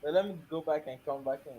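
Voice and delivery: quiet, distant-sounding reply; very low. Real, not acted. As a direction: say it softly, away from the mic. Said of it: "you're speaking very low".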